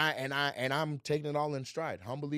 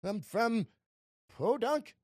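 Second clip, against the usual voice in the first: high, hammed-up comic answer; mock small-town contrast; 7 semitones higher, slower than usual. Man claiming a Podunk, Illinois upbringing, hammed-up. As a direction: stretch out the silly names slowly.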